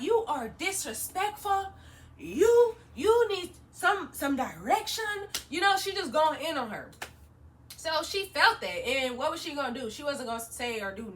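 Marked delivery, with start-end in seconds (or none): 0.0-5.0 slow, stern voice as mom; mom telling her off. Retold or imitated. Mother scolding the rude stylist, slow and stern. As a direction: lay down the law like a mother. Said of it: "So my mom was like".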